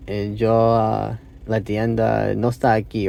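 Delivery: slow, halting Spanish with searching fillers; broken Spanish. Real, not acted. Learner explaining the car needs oil, halting broken Spanish. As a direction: build it word by word in beginner Spanish. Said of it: "say it in my broken Spanish".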